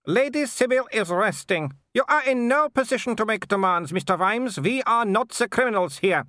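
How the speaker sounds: high-pitched imperious aristocrat; scornful Baroness. A narrator's reading. Baroness scorns the threatening visitors, high and haughty. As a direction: look down on them, voice pitched far up.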